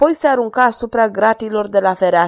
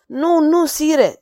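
high, louder desperate cry; 8 semitones higher, much slower than usual. Acted. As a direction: cry out begging at the king's feet. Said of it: "strigă în gura mare".

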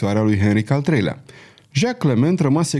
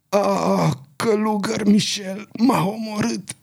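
high, slow, faint cry of a stabbed man; dying murmur; 9 semitones higher, slower than usual. A narrator's reading. Wounded king crying out to Crillon, faint and high. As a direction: gasp it out as life drains away. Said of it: "cu glas stins, murmură".

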